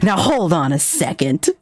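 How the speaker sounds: slow, drawn-out mock objection; mock protest. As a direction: stretch it out to halt someone. Acted startled reply in retold exchange.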